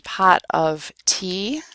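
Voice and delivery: "pot of tea" slow, each word separate; careful pot of tea. Shown as a demonstration. Teacher introducing the phrase, "pot of tea" word by word. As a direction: fully sound "pot", "of" and "tea". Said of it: "don't say pot of tea".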